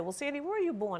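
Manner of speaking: lifted voice for a quoted question; imitated questioner. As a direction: lightly act out someone asking you. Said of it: "if someone said".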